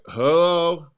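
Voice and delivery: a flat, dragging hello; bored hello. Shown as a demonstration. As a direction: answer the phone like you don't care. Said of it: "If they hear you like".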